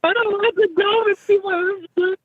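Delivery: mock child's wail, extremely high-pitched; hammed-up high-pitched bawling; bawling and screaming. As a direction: imitate a terrified kid crying and shrieking. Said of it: "bawling and crying and screaming".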